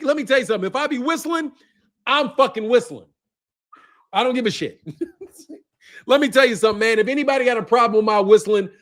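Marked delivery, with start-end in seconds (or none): none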